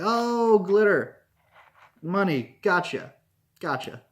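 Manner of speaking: high, loud "oh", then settling; mock realization. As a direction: act out the penny dropping. Acting out sudden understanding of scene.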